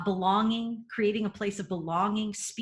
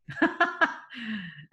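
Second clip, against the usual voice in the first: laugh; 2 semitones higher than usual. Real, not acted. Laughing after cheering on son.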